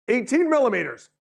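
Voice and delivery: high, loud sudden announcement; mock alarm. Weather service suddenly alerting the smart control, high and loud. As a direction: burst out with the surprise forecast figure.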